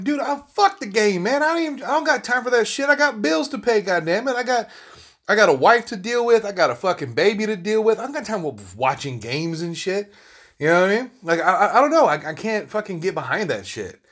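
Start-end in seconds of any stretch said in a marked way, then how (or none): 0.0-10.1 much higher, worked-up venting; exasperated rant. Retold or imitated. Telling a sports fan he has no time, exasperated. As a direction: pile on the excuses, fed up. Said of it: "I'm like".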